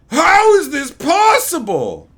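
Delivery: loud, high, slow mock outrage; mocking commenter. Streamer mocking a critic, loud slow outrage. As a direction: ham up the baffled critic. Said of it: "people giving their unasked for opinions".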